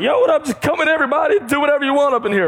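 loud, high, carefree yo what up; buddy yelling a welcome from the stoop, loose and loud; rowdy homie greeting. Retold or imitated. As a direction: hype everyone in like the block's open house. Mock rough neighborhood welcome.

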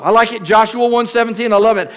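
ordinary talk

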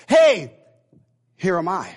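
punchy attention-getting call. Pretend. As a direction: hail the group, pause, then present yourself. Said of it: "to get their attention was this".

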